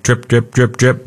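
"drip" repeated in a quick, even beat; rapid dripping. Shown as a demonstration. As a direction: tick out each "drip" like a bad leak. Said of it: "if it's a dripping, like".

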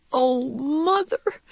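put-on high, slow, tearful child's cry; a little one on the edge of crying; near tears. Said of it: "cried Cynthia, near tears".

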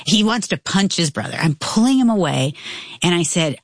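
ordinary delivery

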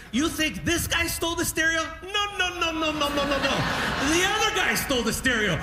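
high, far-off courtroom voice imitating a TV judge; hamming up a TV judge's outburst; mock Judge Judy. Comic doing Judge Judy's courtroom outburst, high mock voice.

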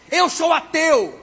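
high, loud, drawn-out declaration; defiant insistence. Retold or imitated. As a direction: act out the atheist digging in. Atheist insisting, acted.

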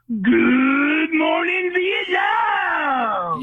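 drawn-out DJ shout, the morning stretched long and the Vietnam flung up high; slow, hammed-up radio shout of the famous greeting; Robin Williams radio call. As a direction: belt it like a wake-up call over the airwaves. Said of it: "Adrian Cronauer when he would say".

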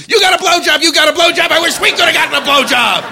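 high cheering crowd chant; mock crowd cheer. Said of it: "They were screaming".

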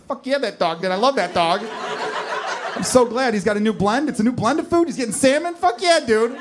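overjoyed, climbing in pitch; hyped-up joy. Acted. Acting thrilled at dog food ad.

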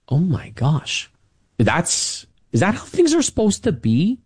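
imitating a stunned young boy on the stairs; bewildered kid. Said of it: "and you're going".

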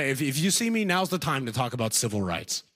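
ordinary talk, nothing marked

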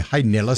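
"Nillis" with a short i, NIL-iss; mispronounced first name. Retold or imitated. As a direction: misread Niles as "Nillis", two syllables. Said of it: "I still get the Nillis".

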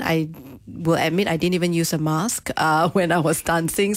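ordinary talk

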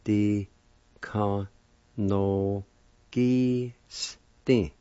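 di, ka, no, gi, sti with a pause after each; syllable by syllable. Shown as a demonstration. Teacher showing the second part of piano, di ka no gi sti syllable by syllable. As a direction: sound out di ka no gi sti one drawn-out syllable at a time. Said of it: "Second part".

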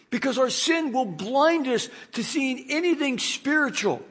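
ordinary delivery

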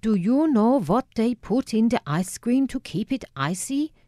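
put-on high, lecturing Dutch-accented old man; sneering Dutch accent. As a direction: quiz the girl with a Dutch sneer. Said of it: "he sneered in his guttural Dutch accent".

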